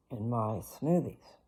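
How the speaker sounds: ordinary delivery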